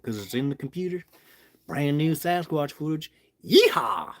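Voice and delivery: put-on Southern twang building to a yeehaw; Southern accent. Shown as a demonstration. Vlogger promising brand new footage, hammed-up Southern accent. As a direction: play it Southern, ending on a loud whoop. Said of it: "Just doing my Southern accent".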